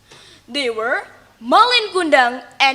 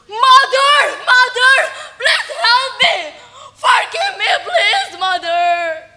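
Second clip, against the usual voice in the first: much higher, loud desperate crying plea; cried loudly; 9 semitones higher, 4 dB louder than usual. Acted. Son begging his mother's forgiveness in the storm, loud desperate crying. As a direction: scream for help in panic. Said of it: "Malin cried loudly".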